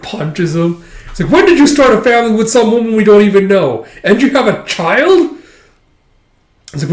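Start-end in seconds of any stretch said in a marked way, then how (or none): 1.3-5.4 high, loud, rapid-fire questioning; outraged demand. Retold or imitated. Companion confronting him about a secret family, outraged. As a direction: erupt in acted disbelief and outrage.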